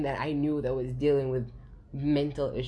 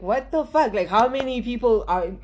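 louder, higher, baffled; mock disbelief, louder and higher; bewildered outburst; 8 semitones higher, 8 dB louder than usual. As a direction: let the confusion burst out. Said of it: "you're always wondering".

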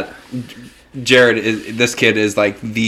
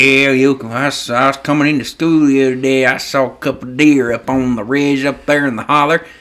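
Jared. Retold or imitated. Hometown kid recounting deer sightings, imitated Southern twang. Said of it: "But Jared was the worst".

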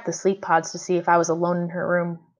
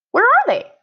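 put-on much higher, louder girl's question; little sister's voice; 6 dB louder than usual. A narrator's reading. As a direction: hunt for the hidden friend. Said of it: "she asked".

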